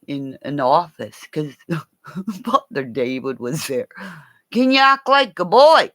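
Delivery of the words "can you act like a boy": loud, high-pitched mock demand; insistent retold request. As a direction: call the question out, pitched way up. Retold request, repeated louder.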